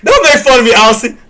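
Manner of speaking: high, loud protest through laughter; laughing protest. Real, not acted. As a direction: laugh while objecting to the teasing. Laughing at being teased in chat.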